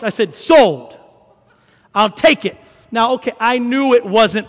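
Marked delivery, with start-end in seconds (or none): 0.3-2.6 loud, decisive bargain grab; emphatic acceptance. Retold or imitated. Buyer accepting a street seller's cheap offer, emphatic. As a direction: punch it out loudly like closing a deal.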